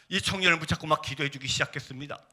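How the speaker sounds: ordinary delivery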